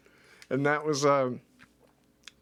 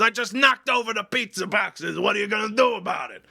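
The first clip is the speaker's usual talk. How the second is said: high, loud, fast provocation; taunting; 8 semitones higher, 5 dB louder than usual. Retold or imitated. Boss goading him over the pizza boxes, loud fast taunt. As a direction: goad him into a fight.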